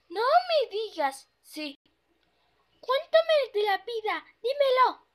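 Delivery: high, pushy pony voice; begging to be told, high and eager; pleading pony. Acted. The pony pleads with her friend to tell her, high and pushy.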